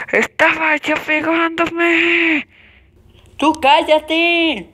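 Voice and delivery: high, loud, slow "cállate"; tattling then shouting. Acted. Brothers argue in front of dad, loud. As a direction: snap at the brother to shut up.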